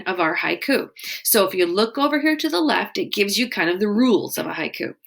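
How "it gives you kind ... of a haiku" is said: usual speaking voice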